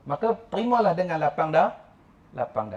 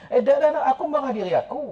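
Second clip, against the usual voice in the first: a hint of someone hastily denying; protesting denial; 6 semitones higher, faster than usual. Complainer denying to a friend, in a higher protesting voice.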